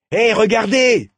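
high, loud, drawn-out call of discovery; excited exclamation. A narrator's reading. Boy alerts his friends to something in the sky, excited exclamation. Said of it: "s'exclama Saïd".

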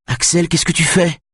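put-on very high, alarmed child's voice; high-pitched, horrified question; alarmed little brother. A narrator's reading. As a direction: cry out in shock at your brother. Little brother rushing at Axel in alarm.

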